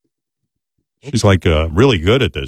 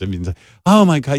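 ordinary talk

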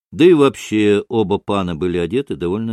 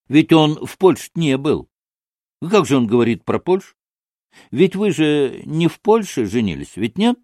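higher, lightly indignant questioning; excitable young disputant; 5 semitones higher than usual. Acted. Kalganov challenges Maksimov's tale of Poland, lightly indignant. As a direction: press the point eagerly.

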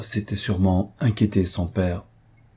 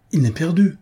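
high-pitched cry with a hint of a sob; acted, understated tearful outcry; sobbing cry; 9 semitones higher, 4 dB louder than usual. A narrator's reading. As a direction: cry out in distress, pitch jumping up. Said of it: "s'écria-t-elle avec un sanglot".

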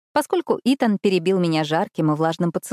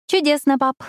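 higher, forced cheerful reply; strained smile; 9 semitones higher, 3 dB louder than usual. A narrator's reading. Daughter humouring her father before leaving, forced cheer. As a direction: answer through a forced smile. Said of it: "с натянутой улыбкой ответила я".

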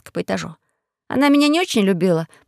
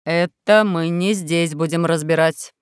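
stern policeman; 6 semitones lower, slower than usual. A narrator's reading.

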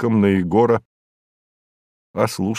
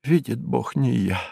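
тихая клятва невиновного; оправдывайся негромко, почти про себя; вполголоса; 6 dB quieter than usual. A narrator's reading. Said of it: "а потом вполголоса". (тихо, вполголоса)

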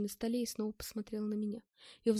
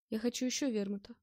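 usual speaking voice

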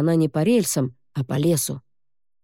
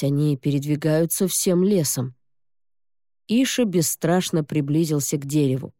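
ordinary delivery, nothing marked

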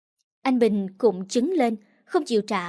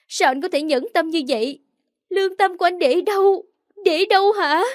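crying in outrage; 7 semitones higher than usual. A narrator's reading. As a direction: hurl the questions at him through tears. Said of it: "vừa khóc vừa uất ức".

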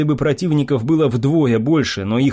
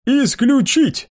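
high, slow spell incantation; casting a spell; 11 semitones higher, much slower than usual. A narrator's reading. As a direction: proclaim the spell word. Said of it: "тут же произнёс".

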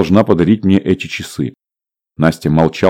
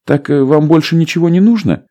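stewardess voice; 9 semitones higher than usual. A narrator's reading.

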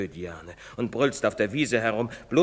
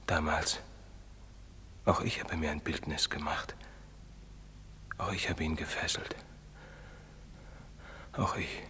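lower, hushed whisper; a priest confessing in a whisper; whispered confession; 6 semitones lower, 6 dB quieter than usual. Acted. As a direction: whisper the guilt like a prayer. Priest confesses guilt, unvoiced.

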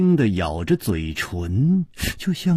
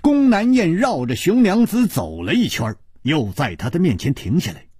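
plain reading voice

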